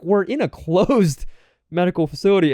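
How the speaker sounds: high, exasperated comic protest; incredulous retort breaking into a laugh; incredulous objection. Retold or imitated. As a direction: argue back in mock disbelief. Acted argument over his stuff.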